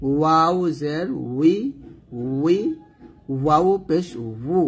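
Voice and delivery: slow, careful syllable drill. Shown as a demonstration. Teacher drilling students on vowel marks, slow. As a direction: spell each syllable out slowly. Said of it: "drilling syllables slowly".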